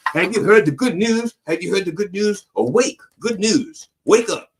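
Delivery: high, sing-song mock preacher at the door. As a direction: lightly ham up a pushy doorstep evangelist. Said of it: "trying to sell you a magazine going".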